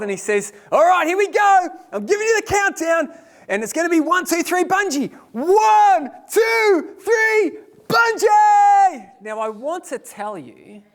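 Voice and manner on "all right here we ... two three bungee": high, building shouted countdown; hyped countdown. Retold or imitated. Operator counting down the jump, high and shouting. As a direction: rev him up to jump.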